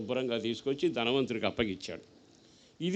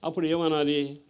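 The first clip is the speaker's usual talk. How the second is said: ordinary talk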